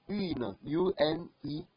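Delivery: Une then U N E spelled very slowly; slow spelling. Shown as a demonstration. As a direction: spell out U N E letter by letter. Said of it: "You see, we are using".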